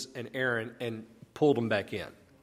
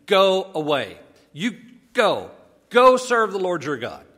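louder, emphatic dismissal, slightly raised; lightly voiced Pharaoh; 4 semitones higher, 7 dB louder than usual. Pharaoh reluctantly sending Moses off, a loud dismissal. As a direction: order them out as Pharaoh, with force. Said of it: "this is Pharaoh saying, "Go away".

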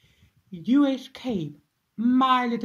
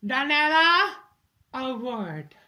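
loud call; 2 semitones higher, 5 dB louder than usual. Acted. Calling her daughter over.

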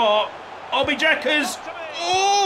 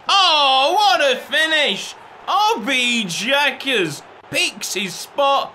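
usual speaking voice

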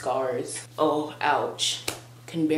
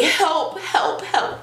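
laughing mock cry; 12 semitones higher, 3 dB louder, much slower than usual. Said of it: "I was like".

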